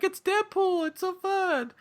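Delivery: put-on very high little-kid voice. Little trick-or-treater spotting Deadpool, imitated high kiddie voice. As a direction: imitate an excited small child in a high pitch. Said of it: "like, a little kid".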